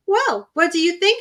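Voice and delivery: sister asking. A narrator's reading. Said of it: "she asked, taking the third position".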